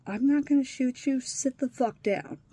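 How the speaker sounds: loud, forceful retold order, slowing on the last words; stern police officer telling a suicidal teen to sit down, loudly; firm command to sit down. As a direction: bark the order like a cop taking control. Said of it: "that cop actually had some sense to go".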